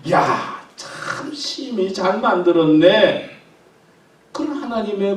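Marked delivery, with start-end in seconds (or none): 0.1-3.3 higher, louder, slow and beaming; overjoyed. Shown as a demonstration. God marvelling at creation, slow joyful praise. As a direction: linger on it with delight. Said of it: "기분이 업이 돼가지고".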